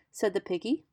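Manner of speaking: ordinary delivery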